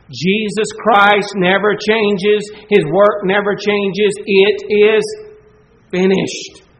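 loud, slow, hammering each phrase; emphatic preaching. Acted. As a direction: preach it with full force, word by word. Preacher driving home point.

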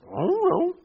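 high, goofy cartoon dog voice; Scooby-Doo voice. Pastor jokes to the church in a cartoon dog voice. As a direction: play the cartoon dog shrugging it off. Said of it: "in the voice of Scooby-Doo".

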